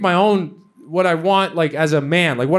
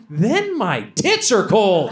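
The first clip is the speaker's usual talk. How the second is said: slow, drawn-out comic whine; hammed-up indignant objection; whiny mock protest; 3 semitones higher, much slower than usual. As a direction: stretch each word in petulant complaint. Acted whiny protest to girlfriend.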